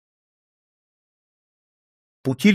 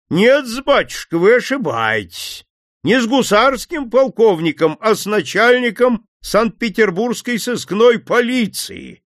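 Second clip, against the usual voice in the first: loud, commanding, higher; 9 semitones higher, 4 dB louder than usual. Acted. Detective stepping out of hiding, loud and commanding. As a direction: announce yourself boldly. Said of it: "громко проговорил Путилин".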